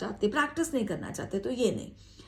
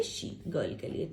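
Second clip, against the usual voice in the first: breathy whisper, much softer; whispered aside; 7 semitones lower, 10 dB quieter, slower than usual. Real, not acted. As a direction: drop to a whisper to hint the answer. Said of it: "whispered aside". (फुसफुसाते हुए संकेत)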